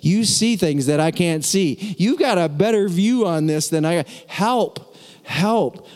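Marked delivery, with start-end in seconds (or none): 4.3-5.9 pleading. Acted. Pleading in prayer.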